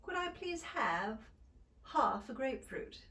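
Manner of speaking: polite British request, half with ah; Standard British. Shown as a demonstration. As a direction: model a British order. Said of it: "For example".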